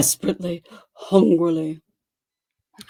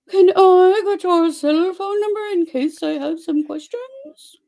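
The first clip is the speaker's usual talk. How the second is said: higher put-on woman's voice asking; heroine asking sweetly; 9 semitones higher than usual. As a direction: lift into her voice for the ask. Narrator voices heroine's request.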